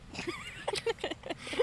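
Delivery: high breathless wheezing laugh; wheezy laugh. Real, not acted. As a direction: laugh until you wheeze. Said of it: "sounds like you're wheezing".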